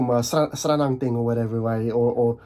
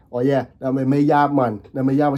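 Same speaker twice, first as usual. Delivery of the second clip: put-on a light, playful Jamaican accent; 4 dB louder than usual. Joking to viewers, a light playful Jamaican accent. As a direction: toss off a fun Jamaican accent. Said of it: "some sort of Jamaican accent".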